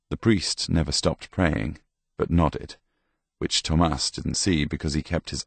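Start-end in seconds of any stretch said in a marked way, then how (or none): none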